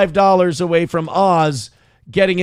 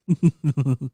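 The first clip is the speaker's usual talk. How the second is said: laughing. Real, not acted. Laughing at a donation message.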